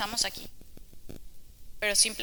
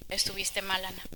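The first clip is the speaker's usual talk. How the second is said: inner voice; 3 semitones lower, 8 dB quieter than usual. Retold or imitated. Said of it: "viene en mí".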